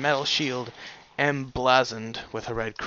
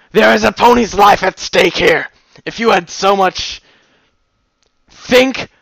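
loud, high, furious shouting; furious roar; 6 semitones higher, 10 dB louder than usual. Acted. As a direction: roar the threat at her. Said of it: "with incredible fury".

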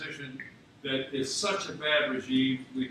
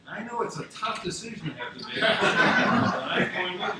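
high, laughing mock-agonised choice; laughing through it; 9 semitones higher, 8 dB louder than usual. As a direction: laugh while pretending it's a hard call. Own quote said laughing.

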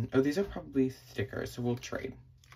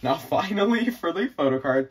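normal talking voice

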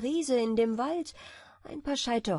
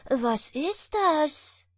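slow, high, amazed question; astonished question; 6 semitones higher, 4 dB louder, much slower than usual. A narrator's reading. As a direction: stretch it out in wonder. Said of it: "rief der Schneider". (staunende Frage)

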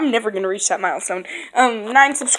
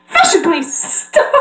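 high theatrical command; fashion pony impression; 6 semitones higher than usual. Fashion pony halting an offender, dramatic high order. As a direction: halt them in a high cartoon voice. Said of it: "next is Rhodey".

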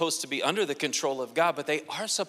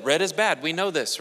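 usual speaking voice

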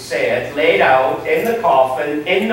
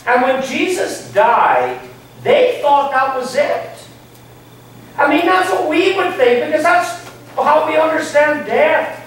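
preaching higher and more forceful, leaning into each line; rising sermon fervor; 6 semitones higher than usual. Acted. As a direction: build the sermon with rising passion. Preacher builds to full force.

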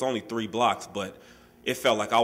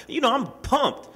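pumped up; 12 semitones higher than usual. Retold or imitated. Retelling his excitement after tournament.

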